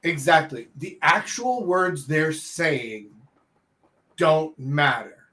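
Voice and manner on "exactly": usual speaking voice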